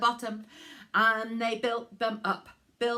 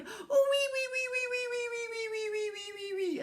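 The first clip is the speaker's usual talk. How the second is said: high squeals; 12 semitones higher, faster than usual. Acted. Pig squealing running away.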